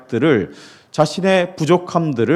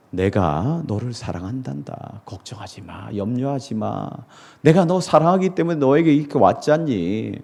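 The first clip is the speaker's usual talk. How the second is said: tender reassurance; 3 semitones lower, 3 dB quieter than usual. Retold or imitated. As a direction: speak like a gentle father. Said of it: "예수님은 물으시고". (예수님이 베드로에게 천천히 다정하게)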